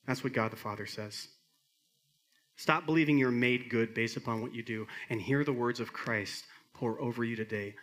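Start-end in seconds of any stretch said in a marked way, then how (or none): none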